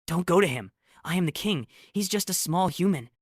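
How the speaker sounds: high, loud desperate plea; pleading. A narrator's reading. Heartbroken king trying to hold his queen back, pleading. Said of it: "crying about how she said".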